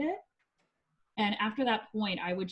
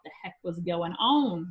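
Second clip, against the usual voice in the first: usual speaking voice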